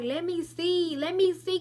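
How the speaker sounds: eager classmates. Retold or imitated. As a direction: imitate classmates pushing to see. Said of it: "everybody was like".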